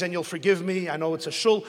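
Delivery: ordinary delivery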